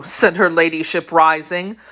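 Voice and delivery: plain reading voice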